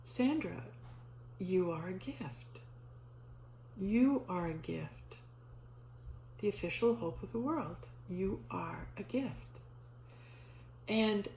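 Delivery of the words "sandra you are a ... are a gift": slow and soft, each word laid down gently; a calm voice from above, unhurried and hushed; softer, slower, tender reassurance; God's gentle voice. Retold or imitated. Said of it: "And then God says to me".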